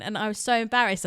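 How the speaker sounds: normal talking voice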